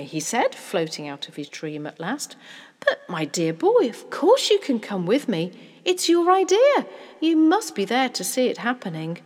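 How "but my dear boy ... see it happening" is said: loud, rising to a high, emphatic insistence; hearty, excited fatherly assurance; delighted father. Acted. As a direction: welcome the child in wholeheartedly.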